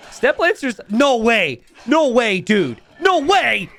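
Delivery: high, loud no way; can't believe your eyes; laughing shout of no way; excited disbelief. Real, not acted. Caster disbelief at fight.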